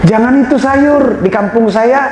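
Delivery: high-pitched eager villager warning; high, insistent village voice; excited local. Acted. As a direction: warn him urgently in a high voice. Villager warning about local dish.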